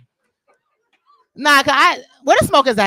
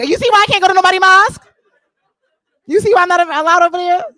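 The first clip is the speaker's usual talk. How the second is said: laughing fast exclaim; 9 semitones higher, 7 dB louder than usual. Real, not acted.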